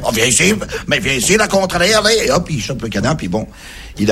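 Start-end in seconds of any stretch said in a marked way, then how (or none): 0.0-2.2 chasing tourist. Acted. Belgian chasing the duck.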